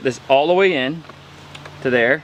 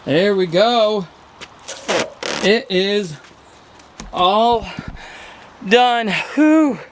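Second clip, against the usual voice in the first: relieved and triumphant; 7 semitones higher, much slower than usual. Real, not acted. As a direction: let out a happy whew. Finishing the railing, relieved.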